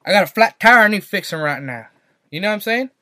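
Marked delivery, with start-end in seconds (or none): 0.1-1.9 hammed-up Southern twang; mock Southern accent. Shown as a demonstration. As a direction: imitate a Southerner wanting a repair. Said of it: "That's how they speak over here".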